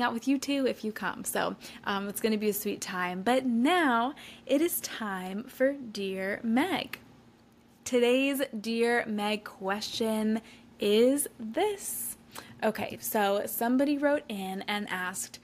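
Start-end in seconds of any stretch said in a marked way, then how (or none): 3.2-12.2 slow, louder announcer intro; segment intro. Acted. Host introducing her Dear Meg question segment, slow and announcer-like. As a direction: present the segment like a show host.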